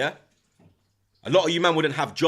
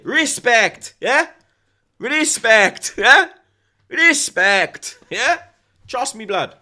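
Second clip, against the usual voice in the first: higher, louder mock Jose voice drawing out respect; Jose impression; 9 semitones higher, 8 dB louder, much slower than usual. Streamer quoting Jose to viewers, loud high impression. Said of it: "In the words of Jose".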